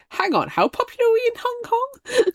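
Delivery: high-pitched mock disbelief; retold surprise. Co-host recalling seeing the Hong Kong listeners, surprised. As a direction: squeal it like astonished news to yourself. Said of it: "I looked and I was like".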